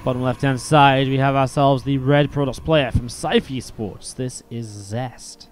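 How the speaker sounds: slow, drawn-out player introduction; slow announcer read; announcer intro. Acted.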